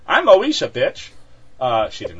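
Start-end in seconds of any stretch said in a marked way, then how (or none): 0.1-1.1 loud, high mock boast; brash mock outburst. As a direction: burst out with hammed-up self-importance. Invented brash quote after mock condolences.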